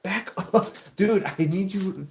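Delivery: pet talk. Real, not acted. Calling his dog.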